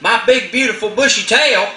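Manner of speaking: high, hushed protesting voice; alarmed Possum. Acted. Possum protesting to Cricket, high and alarmed. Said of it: "and he says".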